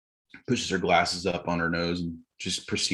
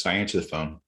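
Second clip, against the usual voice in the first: normal talking voice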